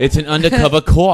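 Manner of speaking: put-on loud, laughing Boston accent. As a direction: belt it out Boston style, laughing. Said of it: "You gotta say it in a Boston accent".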